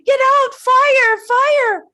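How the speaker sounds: high, loud urgent shout; little bird shrieking at the creatures; bird yelling warning. A narrator's reading. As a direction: scream the warning through the forest.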